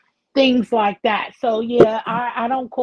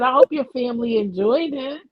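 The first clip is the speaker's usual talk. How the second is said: ordinary delivery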